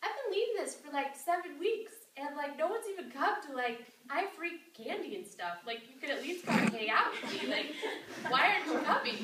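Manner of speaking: Jenny scolding her sisters with a laugh; exasperated plea. Retold or imitated. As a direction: call out your sisters half-laughing. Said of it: "she's like".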